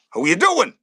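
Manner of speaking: loud, high phone greeting; booming gaffer. Retold or imitated. Manager phoning young player at neighbour's, booming. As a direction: bellow a hearty hello down the line.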